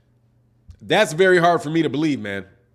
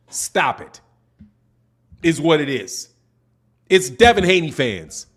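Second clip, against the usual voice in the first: normal talking voice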